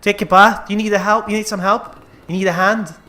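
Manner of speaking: loud, high mocking taunt. Real, not acted. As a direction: tease the zombie loudly. Taunting the zombie.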